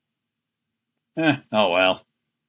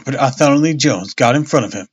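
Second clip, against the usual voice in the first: plain reading voice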